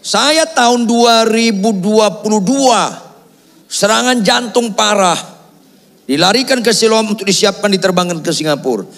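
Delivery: high-pitched, emphatic pulpit delivery; forceful testimony. Real, not acted.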